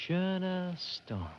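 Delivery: savoring the name. Acted. Publicist asks if the name sings, savoring it. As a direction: make the name sing. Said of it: "does it sing for you?".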